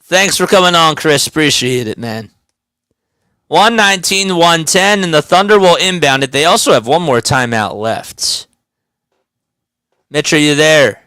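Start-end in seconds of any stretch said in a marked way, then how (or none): 3.5-8.5 live game call; play-by-play. Acted. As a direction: call the action courtside.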